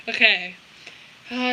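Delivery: ordinary talk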